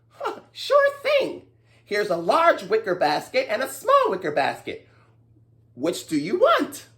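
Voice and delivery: high squeaky mouse voice; little mouse. Acted. Mouse offering the greedy man baskets. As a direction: answer brightly as a mouse.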